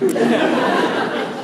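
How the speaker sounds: short, loud, breathy laugh. Real, not acted. Priest laughing at the father's punchline, breathy and loud. Laughing at first subject joke.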